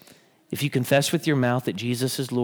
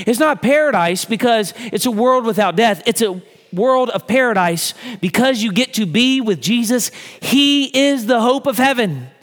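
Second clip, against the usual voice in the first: high, loud climactic preaching; a preacher hammering the point home; full-force preaching; 8 semitones higher, 5 dB louder than usual. Acted. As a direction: drive each line up, rising to a peak.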